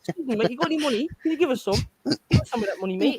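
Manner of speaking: high, wheedling Scouse beggar; high-pitched Scouse impression; Scouse accent. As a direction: cadge money in Scouse. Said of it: "Can you do a scouse?".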